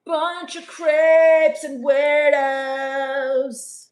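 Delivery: each word stretched long and loud, creepy; slow creepy drawl. Acted. Vampire streamer to her chat, slow and creepy. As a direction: savour every word like a vampire.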